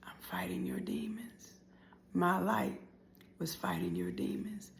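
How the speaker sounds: slow, whispery poetic delivery; soft, solemn whisper; hushed refrain. Acted. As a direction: whisper it slowly, with pauses.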